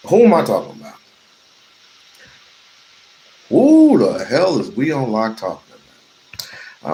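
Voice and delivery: loud, high showman questions; hyped rhetorical shout. Acted. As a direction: tease the audience big.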